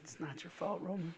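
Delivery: very soft, low mock comfort; hushed consoling. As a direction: murmur it low, as if consoling him. Mock consoling to make him cry.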